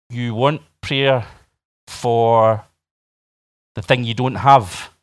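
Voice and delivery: slow, halting recap with long gaps; incredulous spelling-out. Acted. Pastor repeating an odd prayer request, slowly incredulous. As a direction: lay it out piece by piece in disbelief.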